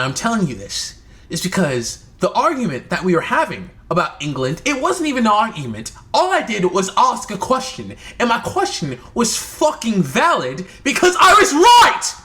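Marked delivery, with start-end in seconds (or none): 4.7-12.1 higher, heated self-justifying rant; real exasperation rising into a shout; indignant. Real, not acted. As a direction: build to a loud, high outburst of being right. Indignant rant about sister argument.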